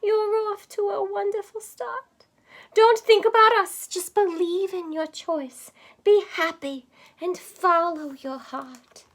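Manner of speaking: high, loving mother's voice; quavering mother. Acted. Mother in a dream encouraging her daughter, high and loving. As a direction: coax her gently as a proud mother. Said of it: "in a quavering voice".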